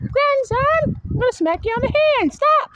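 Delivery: a mock-stern elder snapping at a child; mock scolding voice. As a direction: scold the grandson in a put-on voice, quick and high. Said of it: "see how I said I'm gonna smack you on the hand".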